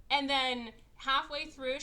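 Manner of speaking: ordinary talk, nothing marked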